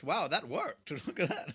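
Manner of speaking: usual speaking voice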